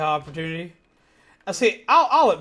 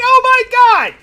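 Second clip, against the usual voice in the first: a sudden, much higher, louder shriek; shocked scream; 15 dB louder, slower than usual. Real, not acted.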